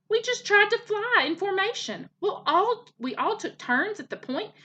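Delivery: put-on high, excited child's boast; eager young gosling. A narrator's reading. Gosling reports formation flying to his mother, excitedly. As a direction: chatter high and keen.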